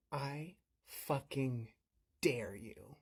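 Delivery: menacing dare. Retold or imitated. Mom answering the man who threatened her kid, quietly menacing. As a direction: spell out the dare slowly, softly dropping. Mother challenges the rude man.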